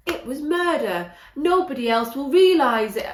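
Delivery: mock an overdone Yorkshire accent; bad Yorkshire accent. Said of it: "That's a really bad accent".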